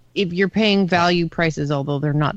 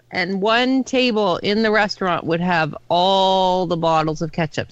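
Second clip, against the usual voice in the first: ordinary delivery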